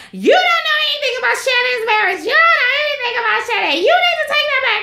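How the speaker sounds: very high, fast hammed-up rant; mock upset Tamara. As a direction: rattle it off like a shrill tantrum. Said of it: "really upset".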